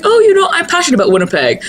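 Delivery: high, fast, gushing put-on voice; lightly mocking enthusiastic voice; mock gushing newcomer. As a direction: parody someone gushing about their city. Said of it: "people are like".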